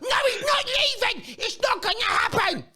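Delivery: high mocking shout of a deluded fan. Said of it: "but you don't".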